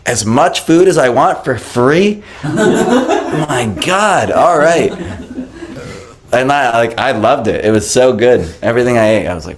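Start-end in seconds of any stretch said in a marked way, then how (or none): 0.1-4.9 high, slow, laughing amazement; laughing disbelief. Retold or imitated. Amazed at being served free prasadam, laughing. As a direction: marvel through a laugh.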